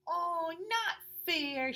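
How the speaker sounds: high, slow mock whine; whiny little sister. Toddler sister protesting the sharing, slow high whine. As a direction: pout the words out.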